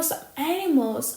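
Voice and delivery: ordinary talk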